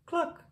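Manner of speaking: high, chirpy cluck; chicken's cluck. Acted. Hen answers the passing truck with a high cluck. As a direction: squeak out a hen's cluck. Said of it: "said a chicken".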